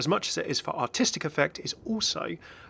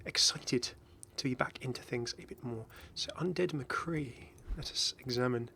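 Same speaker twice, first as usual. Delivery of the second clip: hushed, eerie reveal of the Halloween skin; whisper; 9 dB quieter than usual. Pretend. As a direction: lean in and whisper it like a secret.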